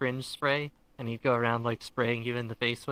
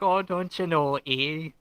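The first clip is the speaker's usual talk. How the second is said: jokey put-on Canadian accent, higher and louder; 4 semitones higher, 4 dB louder, slower than usual. Teasing a friend from Toronto with a jokey Canadian accent. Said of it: "my Canadian accent".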